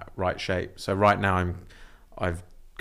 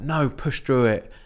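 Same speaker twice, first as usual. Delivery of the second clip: mock-stern order to oneself, higher and drawn out; insistent self-talk; 6 semitones higher, 4 dB louder, slower than usual. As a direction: push yourself on, slowly and emphatically. Acted stern self-talk about injury.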